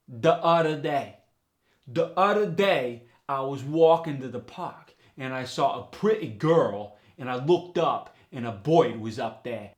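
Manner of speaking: New York accent. Shown as a demonstration. Teacher demos D for TH in New York, loud. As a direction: say "the udder day" in broad New York. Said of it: "The other day becomes".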